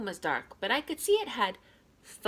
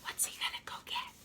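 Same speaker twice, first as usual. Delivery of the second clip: fast, whispered wondering; puzzled whisper; 12 dB quieter, faster than usual. A narrator's reading. Reggie wondering to himself what Ira will fetch, whispered. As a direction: mutter it under your breath, puzzled.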